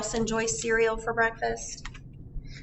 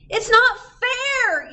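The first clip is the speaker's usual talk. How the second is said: much higher, louder, slow complaint; whiny yell; 12 semitones higher, 8 dB louder, slower than usual. Acted. Grown-ups complain they can't go to school, loud and whining. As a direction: wail it like a kid denied a treat. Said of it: "yelled the grown-ups".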